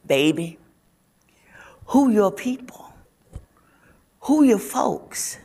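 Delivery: a Southern hairdresser coaxing gently, half in a whisper; Southern accent. Acted. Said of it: "In her deep Southern accent".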